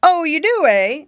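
loud, high mocking retort; sarcastic. A narrator's reading. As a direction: challenge him with scornful sarcasm. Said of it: "sarcastic-like".

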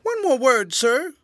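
high, loud whining cry. A narrator's reading. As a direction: call after him urgently. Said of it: "cried the tramp".

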